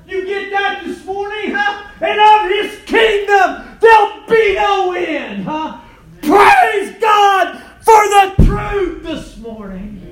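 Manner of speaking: high, loud shouted preaching; preaching at full force. Real, not acted. Preacher shouting to the congregation about Nazareth.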